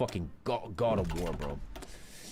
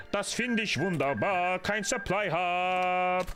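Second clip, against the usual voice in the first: mock lament; 4 semitones higher, slower than usual. Mock lament over missing supply.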